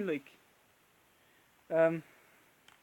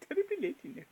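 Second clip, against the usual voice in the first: lightly voiced high fussy Hatter; prissy, hurried and a bit posh; hint of a dotty hatter; Mad Hatter voice; 8 semitones higher, 7 dB quieter, faster than usual. Mad Hatter style voice play.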